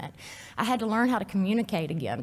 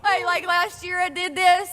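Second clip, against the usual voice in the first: ordinary delivery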